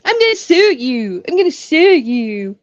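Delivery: a hint of a petulant threat; mock whiny threat. Guest imitating the congresswoman threatening to sue, with a lightly put-on whine. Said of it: "all she'd be saying".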